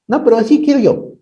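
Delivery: a hint of a pouting little girl; mock child's stubborn reply. Preacher imitating his daughter Dafne insisting, lightly childlike.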